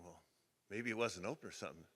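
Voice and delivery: low, hushed musing; thinking to himself. Retold or imitated. Dad in the trailer wondering about the gas station, thinking to himself. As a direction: voice an inward, unbothered guess quietly.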